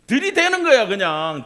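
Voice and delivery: high, loud comic push; catchphrase exhortation. Acted. Preacher urging congregation with a singer's catchphrase, loud and hammed-up.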